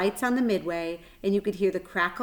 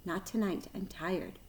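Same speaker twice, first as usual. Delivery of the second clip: faint tired voice; 9 dB quieter than usual. A narrator's reading. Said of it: "Her voice didn't seem to come".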